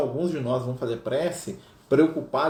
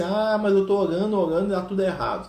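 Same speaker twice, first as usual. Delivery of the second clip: mock complaint; 6 semitones higher, 4 dB louder than usual. Imitating a complaining believer.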